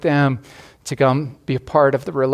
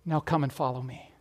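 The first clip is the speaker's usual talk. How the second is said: much softer, a hushed call to follow; soft invitation; 11 dB quieter than usual. Acted. Jesus inviting the listeners to follow, much softer. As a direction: drop to a near hush and invite.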